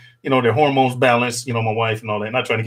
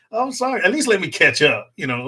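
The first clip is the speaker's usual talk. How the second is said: ordinary talk, nothing marked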